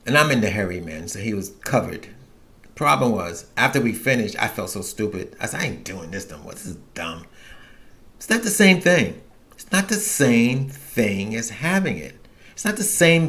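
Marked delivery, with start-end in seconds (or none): 5.6-7.3 quiet, self-disgusted mutter under the breath; low-voiced sheepish regret; muttered to himself. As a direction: grumble it softly to yourself. Disgusted with himself after cam sex.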